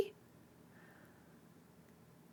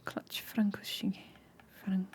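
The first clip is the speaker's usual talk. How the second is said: whispering; 14 dB quieter than usual. Real, not acted. Practising words softly.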